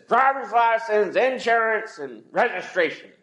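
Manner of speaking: a hint of a hard-to-deal-with officer; stern police officer. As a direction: play the curt cop at the window. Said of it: "bad spirit about him".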